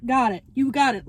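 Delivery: ordinary talk, nothing marked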